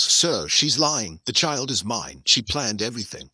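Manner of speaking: ordinary delivery, nothing marked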